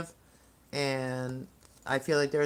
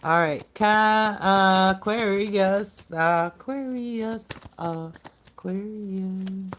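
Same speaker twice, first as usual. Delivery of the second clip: drawn-out calling; 4 semitones higher, 4 dB louder, much slower than usual. Acted. Calling up the next sign.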